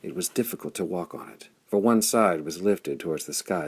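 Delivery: ordinary delivery, nothing marked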